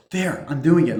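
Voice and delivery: imitated defensive husband, loud and high; mock arguing. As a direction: act out an irritated retort. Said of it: "arguing with his wife".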